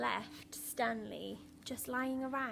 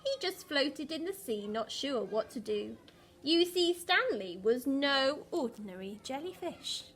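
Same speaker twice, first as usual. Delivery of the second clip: put-on lively read-aloud for young children; storybook reading voice; story narration; 5 dB louder than usual. A narrator's reading. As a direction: tell the story to a class, bright and rhythmic. Reads picture book aloud.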